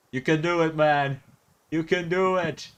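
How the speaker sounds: high-pitched, playful pep talk; mock cheering on. Viewer cheering the man in the video, playfully encouraging. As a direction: urge him on like a sideline supporter.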